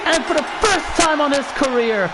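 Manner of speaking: excited play-by-play call; wrestling commentator. Acted. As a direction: build up the big finish. Play-by-play climax.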